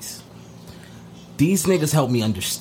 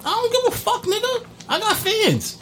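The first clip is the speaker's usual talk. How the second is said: squeaky voice. Acted. High squeaky mock.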